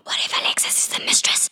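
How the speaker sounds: breathy hushed whisper of a sudden idea. Acted. Darby whispers his suspicion to the party, hushed. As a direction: whisper it like a secret dawning on you.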